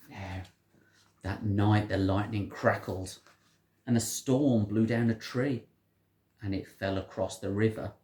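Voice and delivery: usual speaking voice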